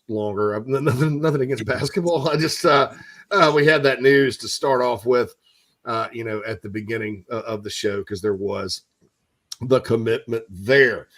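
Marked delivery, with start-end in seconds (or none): none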